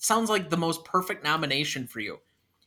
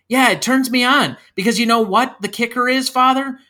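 louder, higher, fired-up admission; worked-up confession; 8 semitones higher, 7 dB louder than usual. Acted. Jeremy admits it to the priest, worked-up and loud. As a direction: build it up like a confession bursting out. Acted confession building in intensity.

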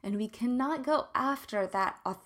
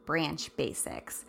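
usual speaking voice

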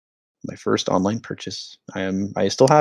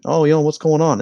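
higher-pitched mock Swedish accent; put-on Swedish accent, pitched up; 6 semitones higher than usual. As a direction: imitate a baffled Swede with a lilting accent. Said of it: "in a Swedish accent".

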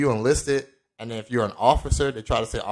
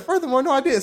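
heated denial. Retold or imitated. Arguing with accuser.

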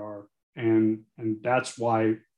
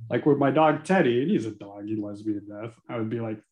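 normal talking voice

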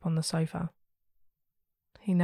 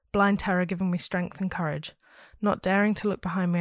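ordinary delivery

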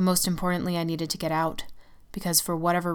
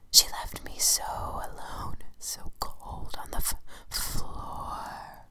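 mournful whisper; 5 dB quieter, much slower than usual. Acted. The shadow keeps begging.

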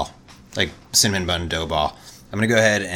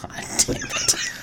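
whispered groan; 5 dB quieter, slower than usual. Real, not acted. Groaning at a bad pun.

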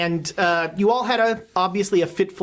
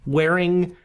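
dream narration; 4 semitones lower than usual. Acted.

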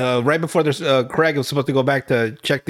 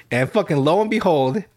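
usual speaking voice

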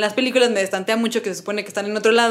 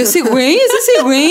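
rising to a loud, high, incredulous cry; blurting out who is that guy, building; shocked recognition; 6 semitones higher, 8 dB louder, slower than usual. Retold or imitated. Recalling her reaction to the film, louder and higher. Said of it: "Y yo".

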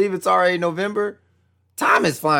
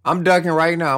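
normal talking voice

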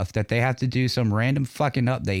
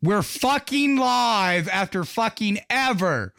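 exasperated; 9 semitones higher, slower than usual. Real, not acted. Exasperated that show finally starts.

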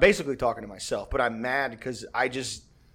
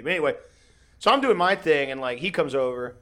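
ordinary talk, nothing marked

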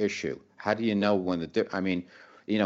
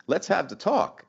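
much higher, mock-eager; mock eager guy; 9 semitones higher than usual. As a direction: pitch it up like a guy eagerly stepping up. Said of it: "guys are gonna step up and say".

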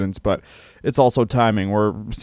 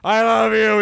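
mock scream; 10 semitones higher than usual. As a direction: holler it as an overwhelmed father would. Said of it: "He just started screaming".

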